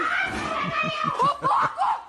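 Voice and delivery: a squealing fit of laughter; shrieking laughter; high shrieking laugh. Real, not acted. Laughing at the rat video.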